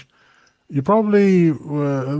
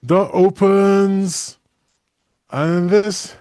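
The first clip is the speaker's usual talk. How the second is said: ordinary talk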